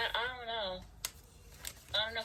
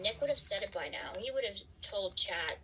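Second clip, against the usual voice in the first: usual speaking voice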